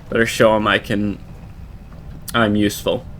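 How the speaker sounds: ordinary talk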